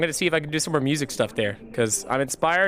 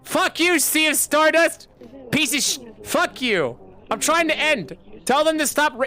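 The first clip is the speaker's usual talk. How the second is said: furious shout; 9 semitones higher than usual. Real, not acted. Streamer yelling at friends who keep interrupting, furious shout. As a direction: scream it like they won't let you finish.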